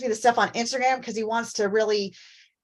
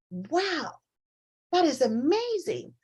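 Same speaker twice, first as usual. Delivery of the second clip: amazed; 6 semitones higher, slower than usual. Retold or imitated. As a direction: draw out a hushed, marveling wow. Acting own amazed reaction to reel.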